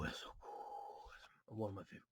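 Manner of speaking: breathy hush of awe at a card; awed whisper. Real, not acted. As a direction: whisper it, amazed. Opening a pack, awed hush.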